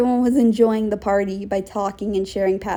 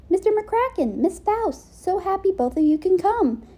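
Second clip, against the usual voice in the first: lightly raised, welcoming host voice; a touch higher, eager welcome; higher character voice; 8 semitones higher than usual. Acted. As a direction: greet the guests brightly.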